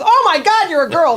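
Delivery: high, loud startled cry; shocked farmer. Retold or imitated. Farmer shocked to find a girl under the helmet, high and loud. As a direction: farmer gasps in disbelief. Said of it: "he goes".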